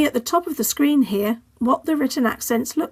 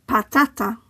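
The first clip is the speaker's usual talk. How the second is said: Patata with the middle TA stressed; stress on middle syllable. Shown as a demonstration. Teacher modelling the stress rule, Patata stressed on second syllable. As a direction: say Patata in Spanish, pa-TA-ta. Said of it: "stress falls on the penultimate syllable".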